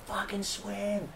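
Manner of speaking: high, soft, drawn-out mock yell; retold urging shout. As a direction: imitate cheering a swimmer on, volume down. Retelling urging on struggling swimmer.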